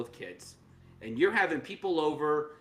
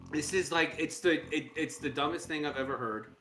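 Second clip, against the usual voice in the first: ordinary talk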